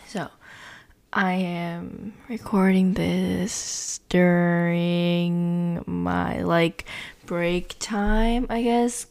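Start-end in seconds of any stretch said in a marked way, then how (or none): 1.1-8.5 drawn-out, slow delivery with stretched words; talking slow. Real, not acted. Podcaster hearing herself while recording, talking oddly slow. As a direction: talk unusually slowly, dragging the words out. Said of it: "I don't know why I'm talking so weird".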